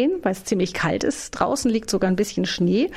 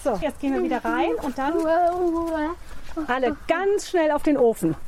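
excited hurry; 6 semitones higher than usual. Real, not acted. Hurrying back in from the snow.

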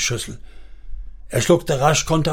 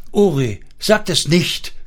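higher-pitched, appalled plea; horrified cry; 5 semitones higher than usual. A narrator's reading. Wife appalled at threat to sell children, horrified cry. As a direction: cry out to stop him saying it. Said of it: "rief sie entsetzt aus".

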